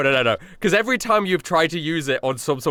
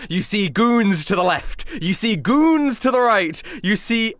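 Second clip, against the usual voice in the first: dramatic narration; 7 semitones higher, slower than usual. Acted. GM scene setting.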